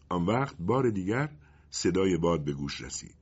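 ordinary delivery, nothing marked

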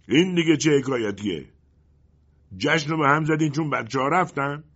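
loud, high-pitched indignant demand; shouting. A narrator's reading. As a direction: shout a protest at departing listeners. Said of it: "پروکولو داد زد".

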